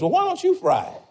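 high-pitched wife talking back; wife's retort. Retold or imitated. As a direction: fire the question back at him. Wife snapping back at husband.

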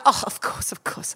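hushed whisper of agreement. Retold or imitated. Agency woman embarrassedly conceding the point, whispered. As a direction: breathe it out in a whisper, sheepish.

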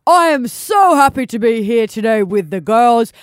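loud, hearty Santa with drawn-out greetings. Acted. Santa welcomes everybody to the season finale, jolly booming voice. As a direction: boom it out slowly like Father Christmas.

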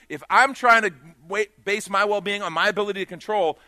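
loud, rising preacher's call; full-force preaching. Acted.

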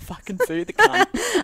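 high, loud mocking echo; hammed-up high repeat. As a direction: squeal the line back. Repeating friend's line, hamming it up.

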